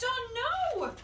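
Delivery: high alarmed oh no. Acted. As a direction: gasp out oh no. Bath bobbing.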